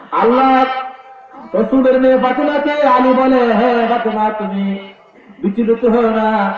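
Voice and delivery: high intoned storytelling; impassioned sermon singsong; melodic preaching. Acted. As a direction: chant it out with fervour.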